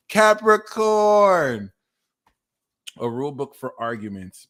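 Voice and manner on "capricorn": drawn-out announcement. Real, not acted.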